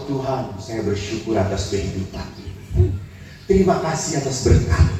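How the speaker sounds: low, subdued praying voice; humble prayer. Retold or imitated. Churchgoer thanks God after cursing in traffic, prayerfully. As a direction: imitate a pious thanksgiving in church. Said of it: "saya berdoa".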